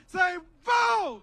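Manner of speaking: very high, loud shout; full rallying shout. Shown as a demonstration. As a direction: shout it across town at the crowd. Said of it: "Say it so loud".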